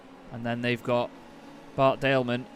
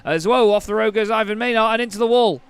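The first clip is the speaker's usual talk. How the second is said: loud, excited race call; motorsport commentator; 6 semitones higher, 6 dB louder than usual. Acted. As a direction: call the race start at full pitch. Live race play-by-play at start.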